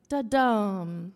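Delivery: slow da-DUM, the dum loud and drawn out; light da, long heavy dum; mistaken falling demo. Shown as a demonstration. Teacher botching a trochaic example, slow stress on dum. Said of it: "sorry, I said that wrong".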